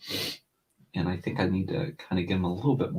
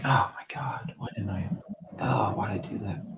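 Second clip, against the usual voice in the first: whispered, dismayed at own drawing; whispered self-critique; 6 dB quieter than usual. Retold or imitated. Artist seeing flaws the next day, whispered dismay. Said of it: "I'm like".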